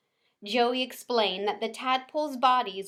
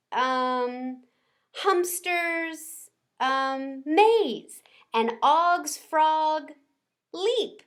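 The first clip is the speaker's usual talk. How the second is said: hesitant barker, higher and slow; nervous showman attempt; 6 semitones higher, 5 dB louder, much slower than usual. A narrator's reading. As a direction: try the big voice, stumbling. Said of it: "took a good long breath".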